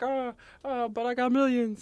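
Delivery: high, puppet-like Muppet voice. Retold or imitated. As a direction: imitate Super Grover, high and silly. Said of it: "You sound like a Muppet".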